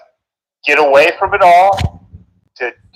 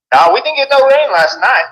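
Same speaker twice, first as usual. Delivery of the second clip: Texan accent; 4 semitones higher than usual. Shown as a demonstration. Asked for a greeting, speaks in a strong Texan accent. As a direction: talk like a Texas rancher about the drought. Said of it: "That's Texan".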